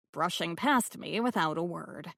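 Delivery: usual speaking voice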